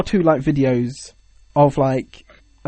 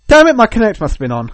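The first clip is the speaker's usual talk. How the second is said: higher, louder outburst starting hard; mock exasperation at the gadget; exasperated; 5 semitones higher, 6 dB louder than usual. Imagined players cursing their Kinect as windows pop up, exasperated. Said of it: "they'd be like".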